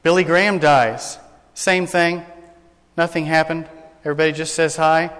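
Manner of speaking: ordinary talk, nothing marked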